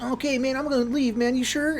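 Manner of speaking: high, whiny hippie voice; imitating a hippie. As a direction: sound like a clueless hippie asking. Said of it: "And he was like".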